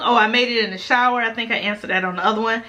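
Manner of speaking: ordinary talk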